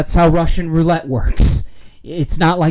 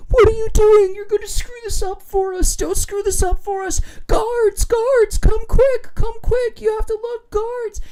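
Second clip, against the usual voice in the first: panicky cellmates. Retold or imitated. Cellmates panicking, calling the guards.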